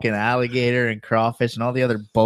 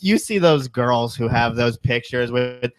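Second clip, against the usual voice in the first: normal talking voice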